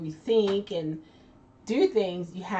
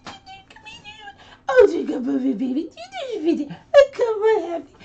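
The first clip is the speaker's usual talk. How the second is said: put-on very high, loud, laughing baby talk; squealing doggy baby talk; 8 dB louder than usual. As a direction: coo at the dog like a baby, giggling. Voicing baby talk to dog Happy.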